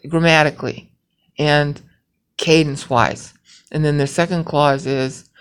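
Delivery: ordinary talk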